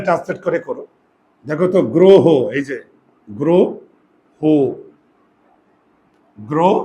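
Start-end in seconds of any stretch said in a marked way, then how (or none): 1.9-2.2 গ্রহ with both vowels as a closed "o", "gro-ho"; pronunciation demo. Shown as a demonstration. Teacher shows students the two o sounds, carefully sounded-out গ্রহ. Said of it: "এটার উচ্চারণ হচ্ছে". 3.3-6.7 গ্রহ broken into "gro... ho", each o held; syllable demo. Shown as a demonstration. Teacher asks how many o's there are, syllable-by-syllable গ্রহ. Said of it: "এখানে ও কয়টা?".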